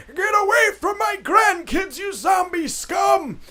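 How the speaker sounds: put-on very high, loud old-man shriek; crotchety old man. Old man protecting grandkids from zombies, crotchety shout. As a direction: yell like a cranky grandpa defending the kids. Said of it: "crotchety old man come down".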